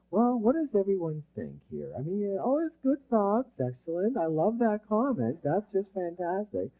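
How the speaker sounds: high, soft, sugary encouragement; overly polite. Acted. Leader humoring a lame team, exaggeratedly polite. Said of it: "you're just being so polite".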